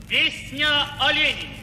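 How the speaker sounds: usual speaking voice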